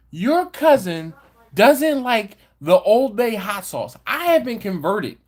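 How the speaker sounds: calling out. Real, not acted. Calling news to cousin Angela.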